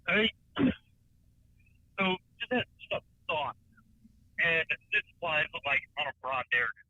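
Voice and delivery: faint caller over a bad line; distant, broken phone audio; phone speaker. Real, not acted. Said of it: "I can barely hear you".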